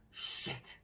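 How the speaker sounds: a retold whisper of sudden panic; panicked whisper. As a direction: breathe it out in a frightened hush. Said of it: "and I was like".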